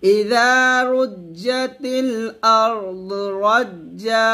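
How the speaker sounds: melodic recital, Razza drawn out; Quran recitation. Shown as a demonstration. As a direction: recite the verse in full. Recites the verse. (তিলাওয়াত)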